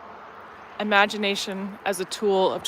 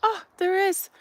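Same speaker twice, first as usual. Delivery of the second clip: high-pitched mock discovery; playful surprise; 11 semitones higher than usual. Finding the cat one wondered about, playfully surprised. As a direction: act delighted to find it really there. Feigned discovery after asking what if.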